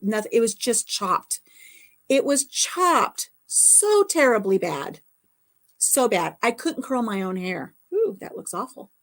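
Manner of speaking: ordinary talk, nothing marked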